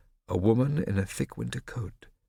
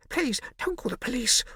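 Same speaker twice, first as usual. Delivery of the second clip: whispered plea. A narrator's reading. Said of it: "Hornet whispered".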